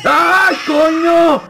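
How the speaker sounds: very high shouted fright; startled scream. Real, not acted. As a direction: scream when it jumps out. Otro susto en el juego. (grito muy agudo de susto)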